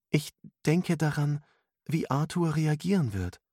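put-on high-pitched girlish character voice; young woman's voice. A narrator's reading. Girl admits to mentor what troubles her, high voice. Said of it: "antwortete das Mädchen".

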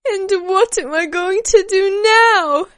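high, loud tearful cry; nervous sobbing wail. A narrator's reading. Girl begging onlookers for help after missing her train, sobbing wail. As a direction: wail it out for everyone to hear. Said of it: "sobbing nervously".

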